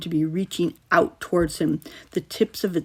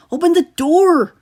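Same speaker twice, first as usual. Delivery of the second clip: high, loud panicked cry; frantic yell to be let in; panicked shout; 11 semitones higher, 8 dB louder than usual. A narrator's reading. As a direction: pound on the door with your voice. Boys panicking at locked door.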